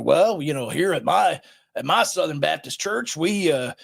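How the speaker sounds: mock Southern preacher accent; J D Greear, Southern accent. Podcaster mocking a Southern Baptist pastor, put-on Southern accent. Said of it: "for doing this demeaning accent".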